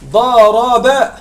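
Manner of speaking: ضرب said very slowly, each root letter apart; stretched root letters for students; spelling out the root. Shown as a demonstration. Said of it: "لأن الحروف الأصلية".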